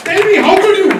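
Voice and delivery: high-pitched mock outrage; indignant comeback. Wife turning the accusation back on her husband, indignant. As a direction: imitate a wounded wife, voice shooting up.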